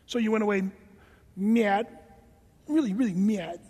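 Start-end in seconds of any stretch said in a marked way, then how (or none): 1.3-1.9 drawn-out, pouty "mad"; babyish mad. Acted. Preacher mimicking people who went away, babyish sulk. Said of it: "why do you say it like that". 2.7-3.6 exaggerated sulky "mad", oddly pronounced; babyish mad. Preacher mocking people denied help, babyish sulk. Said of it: "why do you say it like that".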